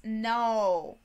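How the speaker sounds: usual speaking voice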